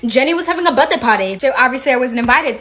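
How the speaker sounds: ordinary delivery, nothing marked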